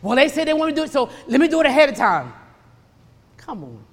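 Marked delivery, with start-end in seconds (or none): 0.0-2.3 mock justification. Mimics people jumping ahead of God.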